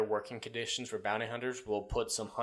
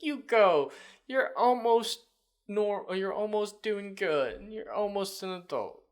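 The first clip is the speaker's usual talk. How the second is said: high-pitched mock parental praise; praising a little kid; 9 semitones higher, slower than usual. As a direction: coo encouragement, as if to a toddler. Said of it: "It's like a little kid".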